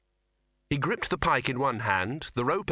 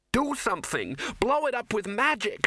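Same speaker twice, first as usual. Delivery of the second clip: high-pitched, demanding cry; urgent shout; 11 semitones higher than usual. A narrator's reading. Ginger to the wizards mid-lecture, high urgent shout. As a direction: shout at them to act. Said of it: "shouted Ginger".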